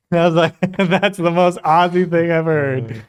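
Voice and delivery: amused, breaking into laughter; laughing. Real, not acted. Telling a travel anecdote to viewers, laughing.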